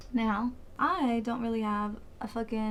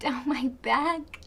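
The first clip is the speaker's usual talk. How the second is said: normal talking voice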